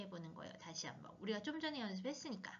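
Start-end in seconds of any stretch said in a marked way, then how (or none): 0.0-1.2 whisper. Real, not acted. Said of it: "teacher whispers instruction aside".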